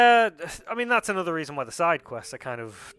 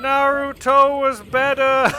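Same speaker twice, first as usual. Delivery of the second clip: loud, slow, high proclamation; mock loud declaration; 11 semitones higher, 12 dB louder, slower than usual. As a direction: bellow it slowly like a verdict.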